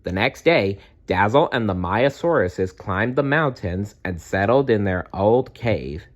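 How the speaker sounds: ordinary delivery, nothing marked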